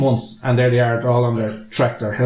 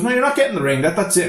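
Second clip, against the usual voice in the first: defiant refusal; 6 semitones higher than usual. Retold or imitated. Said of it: "And he goes".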